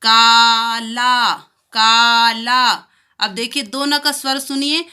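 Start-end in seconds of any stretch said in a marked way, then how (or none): 0.0-2.6 काला very slow, loud, both aa drawn long; stretched aa in काला. Shown as a demonstration. Teacher showing both long aa sounds in काला. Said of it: "देखिए आप कैसे पढ़ेंगे".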